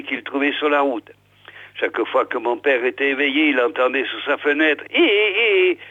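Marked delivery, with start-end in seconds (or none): 4.9-5.8 high, drawn-out goading cry; calling to a donkey. A narrator's reading. Peyrounet goading his donkey under the window, crying out high. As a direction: urge a donkey on with long, high hés. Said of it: "criait-il en piquant son âne".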